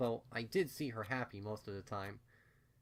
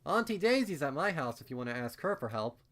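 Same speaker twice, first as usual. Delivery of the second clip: louder call after her; 5 semitones higher, 6 dB louder than usual. A narrator's reading. Narrator calling to the filly at the door, louder. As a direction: call it across the room. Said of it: "I called out".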